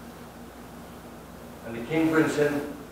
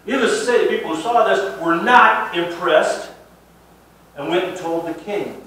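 preaching; 7 semitones higher, 10 dB louder than usual. Acted. Pastor stresses the onlookers' disapproval.

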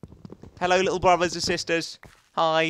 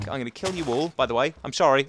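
ordinary talk

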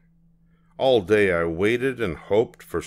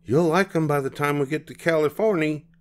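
higher, quicker motherly voice; cheerful mom reassuring; 8 semitones higher, faster than usual. Acted. Mama voiced in story.

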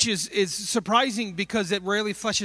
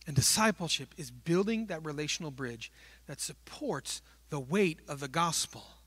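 ordinary delivery

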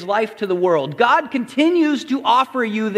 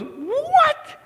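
much higher incredulous What; shocked crowd. Retold or imitated. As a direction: squeal out a baffled What. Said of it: "The crowd just goes".

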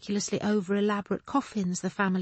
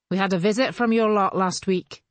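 loud, called across the group; calling over; 5 dB louder than usual. Acted. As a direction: call it over to Moira. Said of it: "called over".

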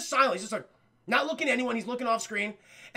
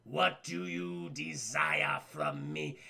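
booming Aku voice; 4 dB louder, much slower than usual. Retold or imitated. Retelling the cartoon to chat, booming as the villain Aku. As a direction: rumble it out like an ancient evil overlord. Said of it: "And Aku goes".

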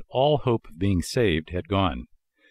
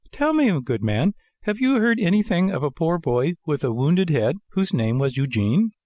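higher puppet voice, asking earnestly; anxious puppet Pinocchio; 7 semitones higher than usual. Acted. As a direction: ask after the hurt boy in a high voice. Pinocchio questions the old man.

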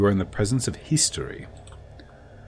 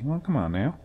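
ordinary delivery

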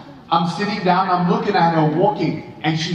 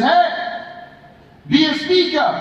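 retold calling out; 7 semitones higher than usual. Friend pestering him in the hallway, calling out. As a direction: call out to a reluctant friend.

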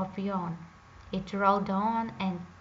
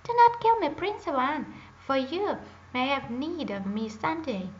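a high, loud squeak of a plea; frightened little hare crying out; talking hare; 4 semitones higher, 4 dB louder than usual. Acted. Hare pleads with the hunting prince, high and squeaky. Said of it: "the hare said in a human voice".

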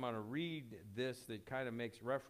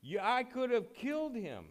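high, loud shouted accusation; retold yell; 7 semitones higher, 5 dB louder than usual. Abner yelling down at David from the cliff, loud and accusing. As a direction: yell it down from the cliff like a furious commander. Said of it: "he yelled down there and he said".

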